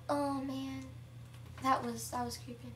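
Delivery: usual speaking voice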